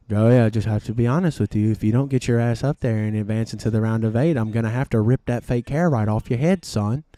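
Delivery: low, quick, stern boss voice; deep and matter-of-fact threat to a driver; team owner on radio. Acted. Team owner warns his driver over the radio, low and stern. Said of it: "Roger is on the mic with him".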